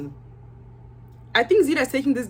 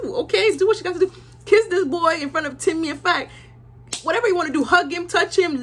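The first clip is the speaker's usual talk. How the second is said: hyped encouragement; 7 semitones higher than usual. Real, not acted. Hyping Zeta to flirt.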